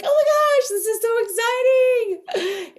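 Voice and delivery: high, loud excited squeal; over-the-top excitement. Shown as a demonstration. Coach showing how Virgo doesn't sound, hammed-up excited squeal. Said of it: "it didn't come across like".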